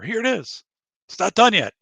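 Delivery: high-pitched call from the doorway; imitating Coop calling out. Coop holding it up in his door, high mock call. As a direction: play him presenting it to the crowd.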